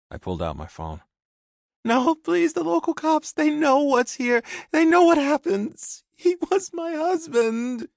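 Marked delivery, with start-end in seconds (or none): 1.8-7.9 very high, loud, frantic begging; terrified woman's plea. A narrator's reading. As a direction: beg him in terror. Terrified sister begs.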